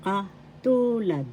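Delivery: Katulad with a long, stressed TU; kaTUUlad, middle syllable drawn out; stress on second syllable. Shown as a demonstration. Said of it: "The second syllable has the accent".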